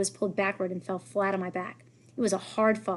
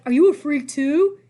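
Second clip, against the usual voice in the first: louder, slow, put-on bully sneer; mock Eddie voice; 7 semitones higher, 10 dB louder than usual. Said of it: "He was like".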